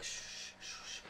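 soft, unvoiced shh sounds; whispery shushes like brushstrokes; painting shush. Imitating a movie stepmom teaching a girl to paint, soft shushing. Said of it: "You gotta make that sound".

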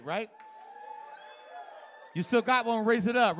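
ordinary talk, nothing marked

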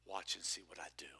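a quiet promise, whispered. Acted. Jesus voiced to disciples.

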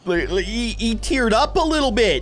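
high, gushing exclamation; playfully overjoyed, pitched high; mock triumph. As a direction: boast grandly like a moved chef. Boasts of moving the customer.

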